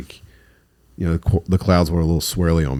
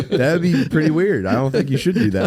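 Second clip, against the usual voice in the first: laughing; 7 semitones higher than usual. Real, not acted. Laughing while answering.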